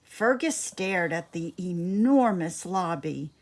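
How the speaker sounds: ordinary delivery, nothing marked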